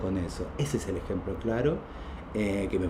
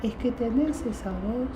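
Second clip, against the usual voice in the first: very high, thin repetition; the driver's squeaky insistence; 12 semitones higher, 3 dB quieter than usual. Retold or imitated. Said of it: "me dice".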